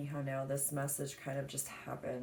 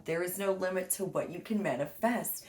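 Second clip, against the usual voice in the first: reading aloud; 4 semitones higher, 4 dB louder than usual. Acted. Reads aloud from a book.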